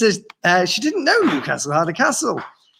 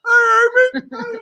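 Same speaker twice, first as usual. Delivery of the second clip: very high squeaky mouse; 3 dB louder, much slower than usual. Pretend. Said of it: "Sounds like a mouse in the room".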